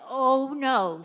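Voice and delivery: loud, high groan of dismay. Retold or imitated. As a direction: caught out, horrified it's her. Dismay at seeing mom.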